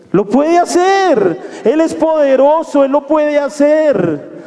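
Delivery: preacher assuring the congregation, emphatic; emphatic preaching. Acted. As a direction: proclaim God's power, slowly. Preaching climax.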